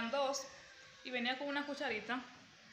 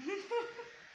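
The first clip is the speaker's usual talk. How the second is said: short high giggle of embarrassment; sheepish little laugh; embarrassed laugh; 9 semitones higher than usual. Real, not acted. Embarrassed about talking mid-bite.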